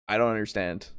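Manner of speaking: ordinary talk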